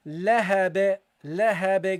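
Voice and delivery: "Lahab" with the final b released in a bounce; measured "Lahab" with an echoed ending; bounced final ba. Shown as a demonstration. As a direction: say "Lahab" deliberately and bounce its last b. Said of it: "You give it a bounce".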